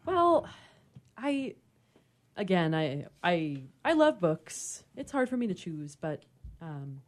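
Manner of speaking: normal talking voice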